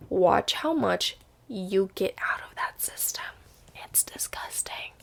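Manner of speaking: hushed aside; whispered confidential aside. Real, not acted. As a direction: drop to a whisper to share a gross secret. Whispered aside about detox.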